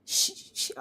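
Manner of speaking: ordinary talk, nothing marked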